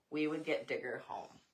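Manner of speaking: plain reading voice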